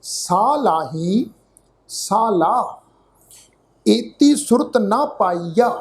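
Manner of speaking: slow, drawn-out scripture reading; slow gurbani recitation. Shown as a demonstration. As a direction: recite each word slowly and carefully. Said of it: "ਇਹਨੂੰ ਸੁਣੋ ਧਿਆਨ ਦੇ ਨਾਲ ਜੀ". (ਹੌਲੀ ਹੌਲੀ ਗੁਰਬਾਣੀ ਪਾਠ)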